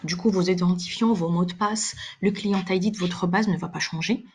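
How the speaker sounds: usual speaking voice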